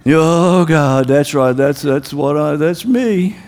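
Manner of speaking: low, sunken acted admission; mock defeated believer, voice dropped low; dejected self-blame. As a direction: hang your head and give in to guilt. Acts believer accepting devil's reminder.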